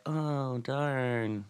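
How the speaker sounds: drawn-out, half-hearted lament; mock dismay. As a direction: stretch it out in insincere regret. Mock reaction of uncaring maids.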